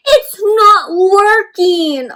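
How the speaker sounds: high, loud hammed-up wail; letters cry to the teacher that it is not working; mock crying letters. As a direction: cry out like a frustrated little letter. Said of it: "cried out".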